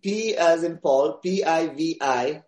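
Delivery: unhurried, clear spelling for the listener; slow spelling out. Shown as a demonstration. Guest spelling a Gujarati word for the host, slow and clear. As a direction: spell out P I V I C H E slowly, letter by letter. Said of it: "Yeah, P".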